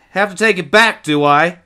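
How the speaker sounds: put-on high, loud boyish challenge; taunting boy. A narrator's reading. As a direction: throw the question back at him. Boy's dialogue in story.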